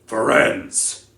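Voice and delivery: a furious centaur bellowing across the clearing; thundering shout. Acted. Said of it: "Bane thundered".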